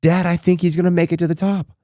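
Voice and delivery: big brother hyping up the little one; five-year-old brother shouting encouragement up the hill; mock screaming. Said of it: "brother is screaming at him".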